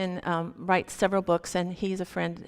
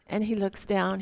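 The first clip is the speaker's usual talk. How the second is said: ordinary delivery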